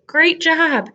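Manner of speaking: normal talking voice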